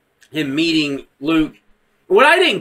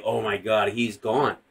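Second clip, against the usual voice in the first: low, soft, awed; quietly stunned, retold; hushed stunned reaction; 7 semitones lower, 7 dB quieter, slower than usual. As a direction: say it under the weight of realization. Quoting own stunned reaction.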